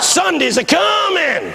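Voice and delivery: high, drawn-out triumphant cry; preacher picking up. Retold or imitated. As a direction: ring out the promise, stretching it long. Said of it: "they're beginning to pick up now".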